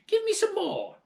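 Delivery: very high, loud witchy demand; greedy crone. Acted. Grandma demands more medicine.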